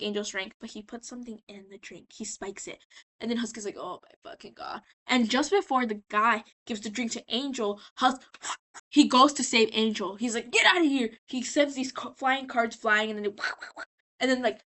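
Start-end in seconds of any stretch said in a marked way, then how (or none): 3.8-4.8 low, very soft acted reaction; imitating quiet disbelief; muted dismay. Acts Husk seeing the spiked drink. 10.5-11.1 high mock yell; raised voice, rescuer stepping in; acted order. Acts Husk rushing to the rescue.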